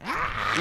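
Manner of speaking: high-pitched strained yell at normal volume; short, held-back screech; grating mock yell. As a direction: imitate an annoying anime scream. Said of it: "it's the most grating yell ever".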